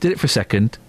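usual speaking voice

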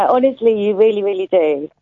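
usual speaking voice

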